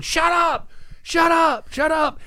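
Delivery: high, loud angry yell; yelling shut up. Retold or imitated. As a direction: yell it into the dark at the noise. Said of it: "I was going".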